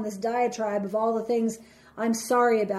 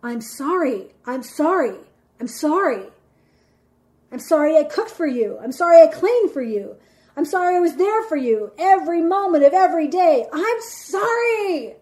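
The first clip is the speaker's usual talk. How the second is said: dramatic mock apologies, escalating to a long high "sorry"; sarcastic diatribe; 5 semitones higher, 6 dB louder than usual. Cheated-on partner launching into a diatribe, bitterly sarcastic. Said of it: "very insincerely".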